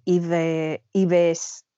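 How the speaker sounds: Yves read as Spanish, with a b-sound at the end. Shown as a demonstration. A speaker showing the Spanish name she avoids. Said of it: "a mí decir en español".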